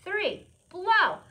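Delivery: loud high cue to blow; called out. Shown as a demonstration. Teacher calling the class to blow together. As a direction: shout the cue for the kids. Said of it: "leads class to blow".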